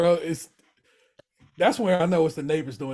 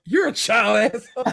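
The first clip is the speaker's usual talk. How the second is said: louder comeback, a bit higher; mock Carmi comeback; 6 semitones higher, 5 dB louder than usual. Carmi retorting to Richie, louder mock jab. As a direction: throw the insult straight back. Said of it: "Carmi responds".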